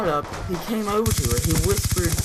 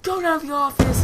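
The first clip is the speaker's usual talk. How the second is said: high-pitched imitation of an angry yell; mock screaming teacher; 10 semitones higher than usual. Teacher sending a student to the office, mock scream. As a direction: act out a teacher's scolding outburst. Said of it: "She just starts screaming at him".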